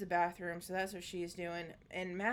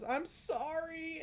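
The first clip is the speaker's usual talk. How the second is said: put-on slow, higher little kid; small child voice; 8 semitones higher, much slower than usual. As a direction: say it like a teary kid.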